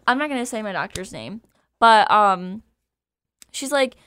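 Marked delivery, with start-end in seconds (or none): none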